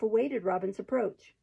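plain reading voice

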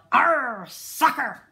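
loud pirate arr; yelled. A narrator's reading. Braidbeard kicking the ball, loud pirate yell. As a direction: growl out arr as you kick. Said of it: "kicked the ball and yelled".

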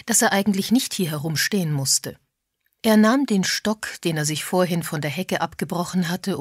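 usual speaking voice

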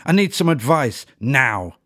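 high, loud angry snap; snapping. A narrator's reading. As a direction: snap it out impatiently. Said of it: "snapped Ella".

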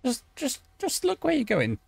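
high-pitched exasperation; exasperated. Real, not acted. Player scolding someone over where they're going, exasperated.

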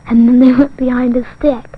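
ordinary delivery, nothing marked

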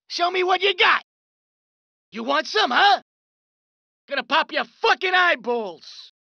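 ordinary talk, nothing marked

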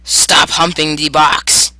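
slow, loud, drawn-out telling-off; loud scolding. Pretend. Player scolding monster at the box, loud scolding. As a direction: shout it slowly, word by word.

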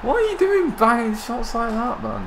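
high-pitched, incredulous exclamation; stunned outburst. Real, not acted. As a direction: react in shock, voice shooting way up. Reacting to a shot in game.

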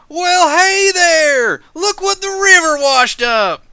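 high, drawn-out holler across a distance; shouting. Acted. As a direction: call out loudly, stretching the words. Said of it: "begins to shout".

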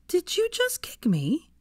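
outraged disbelief, drawn out; slow high astonished protest; indignant surprise. Acted. Hermione kicked under the table.

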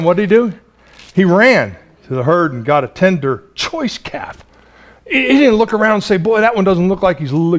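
usual speaking voice

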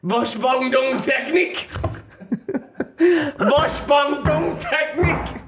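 hammed-up German yell, slow and high; mock German shouting. Mock German shouting at a woman heading for the loo. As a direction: bellow it like a panicking German man.

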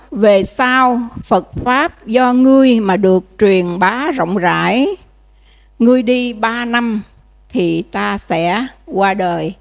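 slow ceremonial reading of a holy text; solemn scripture reading. Acted. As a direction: read the sutra line with weight. Reads the Patriarch's words.

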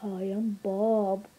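slow, low lightly voiced muffin; a hint of a goofy puppet. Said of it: "Meet my muffin, Bob".

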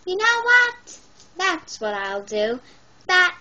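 high, loud burst of excitement; excited exclaim. Acted. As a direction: shout it with sudden resolve. Starfish decides excitedly.

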